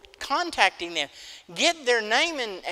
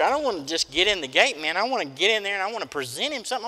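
ordinary delivery

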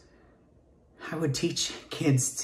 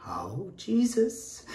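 slow, high, strained Oh Jesus; exasperated sigh; 7 semitones higher, 4 dB quieter, much slower than usual. Acted. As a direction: let it out as a pained plea. Stressed exclamation.

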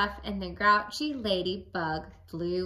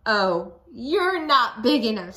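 grouchy put-down; 2 semitones higher, 8 dB louder than usual. Acted. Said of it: "Ready, boys and girls".

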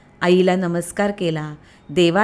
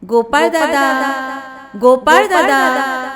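slow, louder calls, stretched out; calling out; 5 semitones higher, 5 dB louder, slower than usual. A narrator's reading. The boy keeps calling for Gopal Dada in the forest, loud slow calls. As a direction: call across the woods for your brother. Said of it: "हाका मारतच राहिला".

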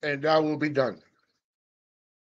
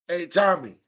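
ordinary talk, nothing marked

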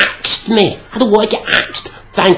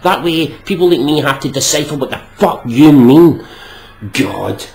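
ordinary talk, nothing marked

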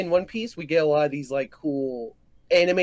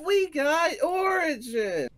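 excited shout; 10 semitones higher, much slower than usual. Real, not acted. Heated fan argument.

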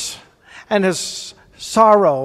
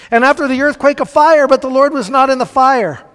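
higher and louder, driving the drama; rising intensity; 5 semitones higher, 5 dB louder than usual. Acted. Preacher dramatizing the fire before the Lord, louder. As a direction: build the fire big. Preacher building the Elijah reading.

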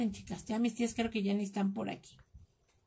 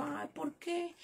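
protesting whine; 6 semitones higher, 7 dB quieter, much slower than usual. Retold or imitated. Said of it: "Y yo siempre le digo".

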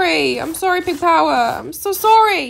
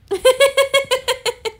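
high, loud, rapid giggle; delighted laugh; 8 semitones higher, 5 dB louder than usual. Real, not acted. Gamer guessed right about a fan, delighted laugh. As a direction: burst into a pleased cackle.

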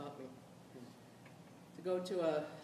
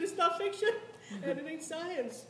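laughing; 10 semitones higher, 3 dB louder, slower than usual. Real, not acted. Laughing at her old play.

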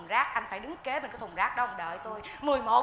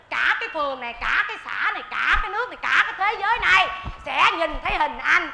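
each phrase louder and higher, up to a gloating laugh; building gloating threat; 3 semitones higher, 5 dB louder than usual. Acted. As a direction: build it up from neighbourhood to the whole world. Escalating blackmail threat. (lời đe dọa hả hê, càng lúc càng to)

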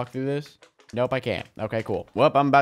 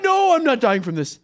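defiant; 8 semitones higher than usual. Real, not acted. Fighting off a cockroach.

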